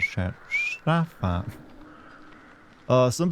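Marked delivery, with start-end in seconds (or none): 0.5-1.4 "Sci-fi" on a long whistled s, starting high; high-then-dropping "Sci-fi" with toothy s; tooth whistle. Shown as a demonstration. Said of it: "that little tooth whistle".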